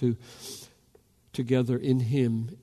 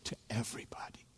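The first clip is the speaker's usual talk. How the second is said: whispered; 3 semitones lower, 15 dB quieter, faster than usual. Real, not acted. Preacher to congregation, closing the thought in a whisper. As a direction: drop to a hushed whisper. Tender close of a point.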